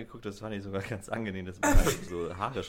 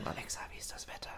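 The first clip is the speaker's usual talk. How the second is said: soft unvoiced whisper to the speaker; whisper to Alexa; 12 dB quieter than usual. Shown as a demonstration. Whispering the question at her voice assistant. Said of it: "Frag, frag sie mal".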